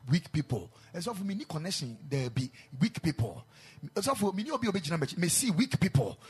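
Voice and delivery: loud rising sermon build; preaching at full force. Acted. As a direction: drive the list of the weak home.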